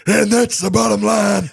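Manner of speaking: high, very loud, drawn-out catchphrase shout; Stone Cold Steve Austin. Retold or imitated. Podcaster showing off a wrestler impression, huge slow shout. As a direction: bellow it slowly like a wrestler's sign-off. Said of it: "very good at doing Stone Cold Steve Austin".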